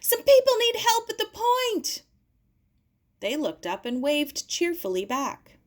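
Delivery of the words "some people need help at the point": very high, loud call down to the beach; shouting. Acted. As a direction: yell down for help.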